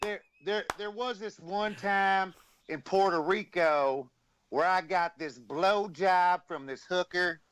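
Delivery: high, slow, drawn-out wrestler voice; slow, high mock Honky Tonk Man; Honky Tonk Man impression. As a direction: ham up a boastful old wrestler. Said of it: "Dude, you did a perfect imitation of him".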